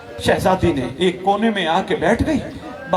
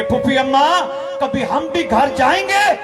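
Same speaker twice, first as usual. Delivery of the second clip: crying call; 9 semitones higher than usual. Acted. Little girl calling to her aunt across the prison, crying out. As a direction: call out, begging through tears. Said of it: "आवाज देके कहती है".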